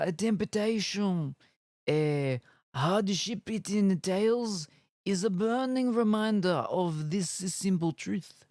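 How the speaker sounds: put-on Italian accent. Rereading the blurb for chat, mock Italian accent. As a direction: lean into a cartoon Italian accent. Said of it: "Now do it all in an Italian accent".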